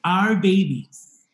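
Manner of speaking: normal talking voice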